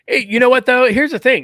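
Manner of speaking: loud, eager hey-listen-to-this; excited interjection. Real, not acted. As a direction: burst in eagerly to grab the floor.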